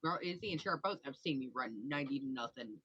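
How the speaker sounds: ordinary talk, nothing marked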